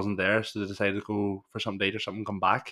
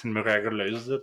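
ordinary talk, nothing marked

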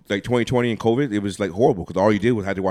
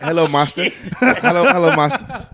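high, loud, sing-song hello; joking fawning welcome to the master; mock servile greeting; 7 semitones higher, 6 dB louder than usual.

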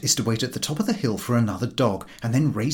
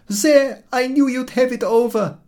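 put-on high, loud dismayed old woman; poor milkwoman; 13 semitones higher, 6 dB louder than usual. A narrator's reading. Milkwoman sees the cart tip over, high loud dismay. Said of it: "says the poor milkwoman".

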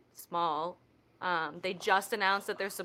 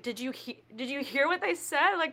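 high, incredulous mock distress; worked-up, raised-pitch retelling; retold dismay; 9 semitones higher than usual. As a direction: plead in exaggerated disbelief. Retold upset reaction to sad song.

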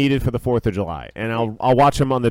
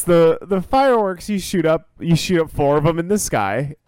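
usual speaking voice

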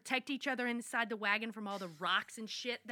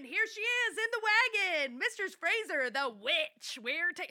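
high mock rabble-rousing cry; retold screaming; 8 semitones higher, 3 dB louder than usual. Brown denouncing Mistress Fraser to townsfolk, mock screaming. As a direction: imitate a man calling out a crowd, raised pitch. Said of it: "he just starts screaming".

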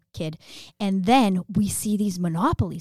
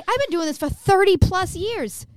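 gloating; 10 semitones higher, 4 dB louder than usual. Real, not acted. Gloating correction.

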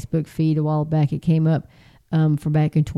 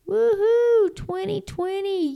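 mock cheer; 13 semitones higher, 3 dB quieter, slower than usual. Mocking cheer for new year.

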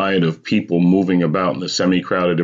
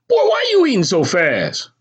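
high-pitched scolding mother; mother's bark; 3 dB louder than usual. A narrator's reading. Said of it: "my mother barked".

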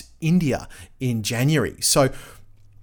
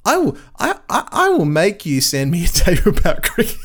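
laughing threat; 5 semitones higher, 5 dB louder than usual. Real, not acted. Laughs mid mock threat.

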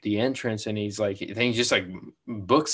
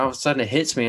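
usual speaking voice

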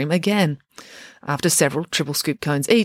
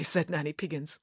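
ordinary delivery

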